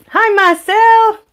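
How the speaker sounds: loud, high, drawn-out hello; real, loud and high greeting to a new arrival; delighted greeting. Real, not acted. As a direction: sing out a big, slow welcome. Greets a viewer joining the stream.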